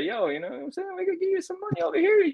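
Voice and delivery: high-pitched mock tampering pitch; sly recruiting whisper. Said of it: "start those whispers in his ear and say".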